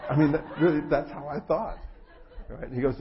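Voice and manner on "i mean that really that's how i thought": laughing. Real, not acted. Laughing at own joke.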